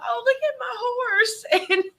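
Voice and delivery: high, drawn-out teary squeal; mock tearful. Woman retelling her gushing over her filly onscreen, mock tearful. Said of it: "I just start crying".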